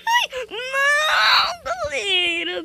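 high, loud, drawn-out wail; wailing cry. Real, not acted. Gamer wailing to viewers as the character falls. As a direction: wail it out slowly in despair.

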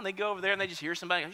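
ordinary talk, nothing marked

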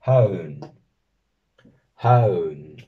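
"haun" with a U vowel glide, not an English W; teacher correcting the W sound. Shown as a demonstration. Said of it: "no hay que pronunciar esta W como una W".

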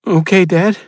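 a scared son pleading with his dad; boy's voice. Acted. Son interrupts his father, high and anxious.

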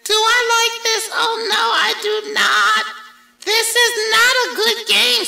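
high, fast, fretful fish; fussy fish voice. A narrator's reading. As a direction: squeak it out like a worried little fish. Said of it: "said our fish".

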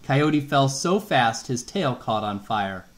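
ordinary delivery, nothing marked

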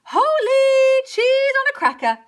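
very high, loud, strained outcry; high strangled exclamation; gasping cow. A narrator's reading. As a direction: squeeze it out slowly as a shocked cow. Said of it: "gasped Cow".

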